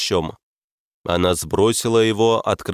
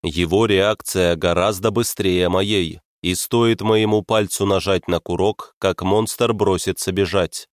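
ordinary delivery, nothing marked

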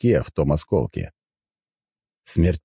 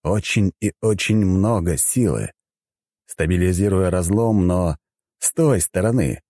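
plain reading voice

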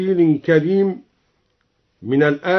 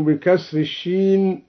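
ordinary delivery

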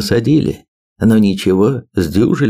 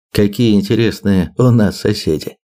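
ordinary delivery

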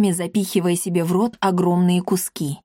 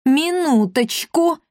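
high, drawn-out interjection; slow, high-pitched exclamation; exclaiming; 8 semitones higher, slower than usual. A narrator's reading. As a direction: break in brightly and stretch the word. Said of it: "воскликнул он".

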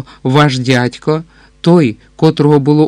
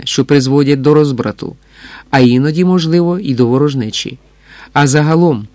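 ordinary delivery

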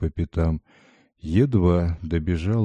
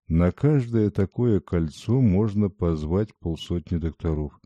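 ordinary delivery

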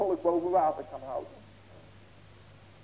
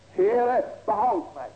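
loud, higher plea to the Lord; crying out; 5 semitones higher, 8 dB louder, much slower than usual. Acted. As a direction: cry for help as the water closes in. Peter crying out, sinking.

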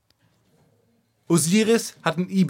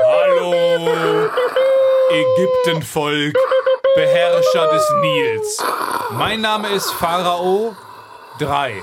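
slow, grand royal address; ranting dictator speech; much slower than usual. Acted. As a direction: proclaim yourself to the people. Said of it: "Volk der Ägypter, hört her".

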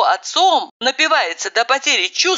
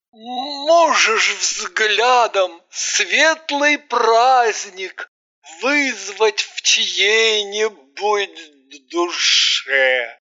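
slow, grandly declaimed verse; hammy stage actor; much slower than usual. A narrator's reading. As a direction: recite with mock pathos. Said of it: "С пафосом воскликнул он".

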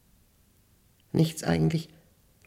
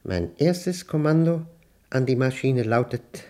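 ordinary talk, nothing marked

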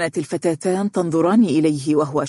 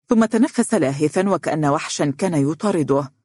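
ordinary delivery, nothing marked

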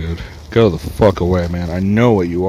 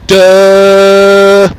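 loud, high, sustained "duh" like a horn; mocking honk imitation; 10 semitones higher, 8 dB louder than usual. Man mocking honking drivers, loud honk imitation. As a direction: hold a dopey honk on one note. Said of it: "Every time someone honks".

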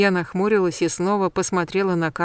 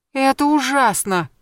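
high, slow cry of dismay; dismayed exclamation; 7 semitones higher, 4 dB louder than usual. A narrator's reading. Heroine hears bad news from her friend, exclaiming in dismay. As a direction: exclaim it, aghast. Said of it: "воскликнула я и схватилась за голову".

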